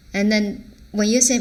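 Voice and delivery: ordinary talk, nothing marked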